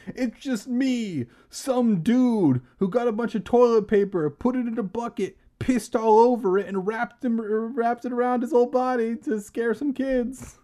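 high put-on comic voice; goofy mummy prankster. Costumed prankster confessing to kids, goofy high voice.

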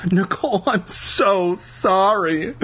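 high, drawn-out tearful wail; crying out. A narrator's reading. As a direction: wail the apology, stretching the words. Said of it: "Lauren cried".

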